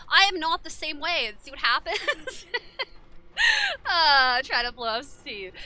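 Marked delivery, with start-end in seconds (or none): 2.5-3.8 very high squealing laugh. Real, not acted. Streamer laughing at chat, high squealing laugh.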